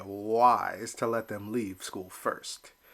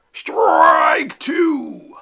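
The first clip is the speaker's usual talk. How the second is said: loud, high umpire shout; umpire's call; 13 dB louder than usual. Acted. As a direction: bellow the call across the field. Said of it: "the umpire called out".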